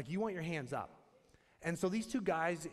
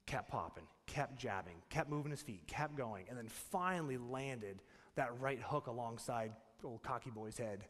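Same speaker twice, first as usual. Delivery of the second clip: quiet sermon build; 6 semitones lower, 5 dB quieter than usual. Acted. Preaching the boxing lesson.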